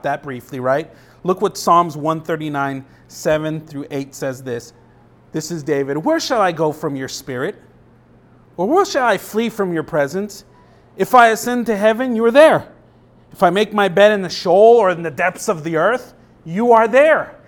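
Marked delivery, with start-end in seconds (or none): 6.0-17.4 reading the psalm, rising to a louder climax; preacher's scripture reading; scripture reading, building. Acted. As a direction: proclaim it, growing stronger each line. Reads Psalm aloud, building.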